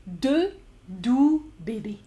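slow careful "Deux doux bébés", deux and doux kept apart; careful demo. Shown as a demonstration. Teacher shows the tricky vowels to the class, slowly. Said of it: "Two soft babies".

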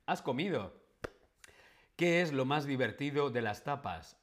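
ordinary talk

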